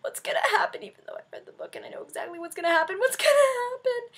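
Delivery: high, anxious squeal; mock panic. Retelling her suspense in the theater, high mock panic. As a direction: fret like a fan in suspense. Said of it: "I was like".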